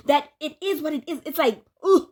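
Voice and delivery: ordinary talk, nothing marked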